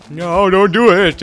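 high, slow, loud plea for its life; squeaky animal voice begging; pleading cow voice. Acted. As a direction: beg like a doomed cow. Cows' voice pleading, game voice.

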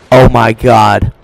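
slow, loud, drawn-out words; stunned disbelief. Real, not acted. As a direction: stretch every word in disbelief. Stunned after being killed.

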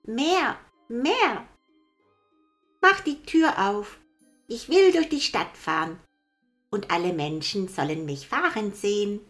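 put-on high, loud child's voice; demanding little boy. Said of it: "schrie Häwelmann".

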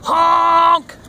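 high, loud, long truck honk; drawn-out honking yell. A narrator's reading. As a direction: blast it out like a truck horn. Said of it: "yelled a dump truck".

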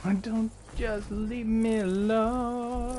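high whiny plea. Real, not acted. Pleading with attacker.

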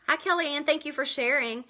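ordinary talk, nothing marked

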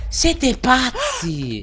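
very high, slow, crying wail; mock wail. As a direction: wail it in disbelief. Outrage at huge weapon damage.

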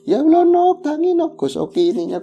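very high, loud fretful wail; anxious wail. Retold or imitated. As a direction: plead with her to wake up. Village women fussing over fainted girl. (ratapan cemas, tinggi dan keras)

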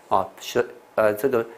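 ordinary talk, nothing marked

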